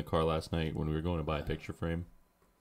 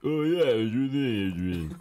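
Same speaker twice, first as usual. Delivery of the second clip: put-on slow, louder Rocky accent, higher; 6 semitones higher, 4 dB louder than usual. Retelling the school film interview in a Rocky accent. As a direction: mumble it like Rocky Balboa. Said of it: "the Rocky accent".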